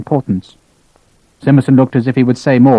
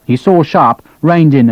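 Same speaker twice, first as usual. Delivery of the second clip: plain reading voice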